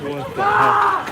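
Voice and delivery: high, slow, stretched cry of disbelief; shocked disbelief. Real, not acted. Stunned reporter hearing another blast, high drawn-out disbelief. Reacting to another sudden bang.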